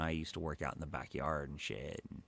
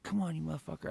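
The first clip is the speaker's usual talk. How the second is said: taunting call; 11 semitones higher, much faster than usual. Retold or imitated. Taunting stepdad to fight.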